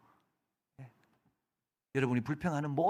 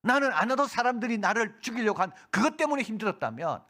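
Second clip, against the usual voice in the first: preaching at full force; 9 semitones higher, 6 dB louder than usual. Acted. Preaching climax.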